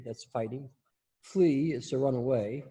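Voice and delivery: ordinary talk, nothing marked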